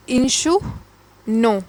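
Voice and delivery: इंशु नो said as insh-oo-no with no r sound; silent r. Shown as a demonstration. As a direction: drop the r: इंशु नो, the r stays silent. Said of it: "आर जो है silent रहता है इसकी pronunciation में".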